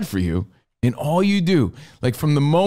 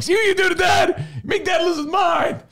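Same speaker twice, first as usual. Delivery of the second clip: screaming. Retold or imitated. Dad screaming at his daughter while driving, screaming. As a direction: scream it at your daughter, losing it. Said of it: "I'm just screaming".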